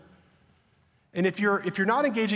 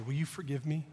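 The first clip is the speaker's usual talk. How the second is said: tender plea; 3 semitones lower, 8 dB quieter than usual. Retold or imitated. Repeated plea to son.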